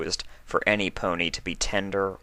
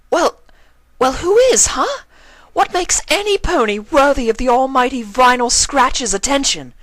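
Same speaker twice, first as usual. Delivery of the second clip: much higher and louder, furious ranting; enraged tearful mare, much higher and louder; 9 dB louder than usual. Acted. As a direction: scream it at her in tearful rage. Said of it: "Octavia looked enraged".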